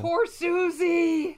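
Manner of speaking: high, slow pitying coo; mock pity.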